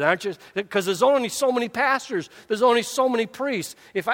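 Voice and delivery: preaching. Real, not acted. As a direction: preach it to the congregation, building. Preaching at full force.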